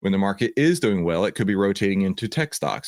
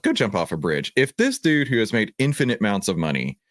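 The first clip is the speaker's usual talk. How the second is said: ordinary talk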